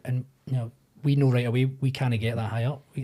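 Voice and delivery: ordinary talk, nothing marked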